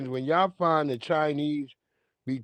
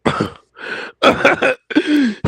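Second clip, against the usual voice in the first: loud high cackle; loud high laugh; 11 semitones higher, 6 dB louder than usual. Real, not acted. Streamer laughing hard at the eyebrows, loud and high.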